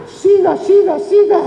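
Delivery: high, excited squeal of encouragement; hammed-up delighted begging; eager mock urging. Mock urging demon to keep praising.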